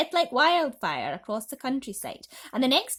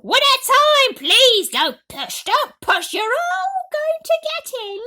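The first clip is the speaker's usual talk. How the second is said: high, loud crowd-control shouts; shouting; 15 dB louder than usual. Acted. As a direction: yell the orders over the crush. Aunt controls pushing crowd.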